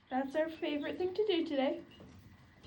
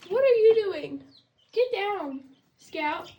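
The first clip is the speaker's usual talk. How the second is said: high, loud scold; real startled telling-off, loud and high; scolding shout; 4 semitones higher, 5 dB louder than usual. Real, not acted. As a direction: catch the pet in the act. Scolding an animal.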